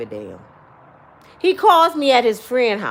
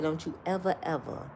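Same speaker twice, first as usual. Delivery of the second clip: low, very soft, deliberate warning; covering the phone to confide a lesson; hushed aside; 9 semitones lower, 12 dB quieter than usual. Retold or imitated. Brother covering the phone to warn his sibling, hushed and stern. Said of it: "He covered the phone up".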